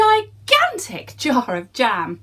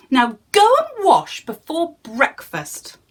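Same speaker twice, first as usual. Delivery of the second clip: higher, louder mock scolding; scolding giantess; 7 semitones higher, 5 dB louder than usual. Giantess scolding the giant, higher and louder. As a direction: tell him off like a bossy wife. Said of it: "the giantess scolded".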